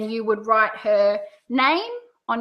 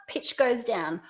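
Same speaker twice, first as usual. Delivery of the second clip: pitch going down; 2 semitones lower, 7 dB quieter, slower than usual. Shown as a demonstration. Coach repeats the second option's tune, pitch down. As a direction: let "down" fall away to a close.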